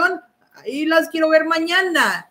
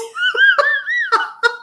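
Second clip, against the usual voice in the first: shrieking laugh. Real, not acted. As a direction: burst out shrieking at the joke.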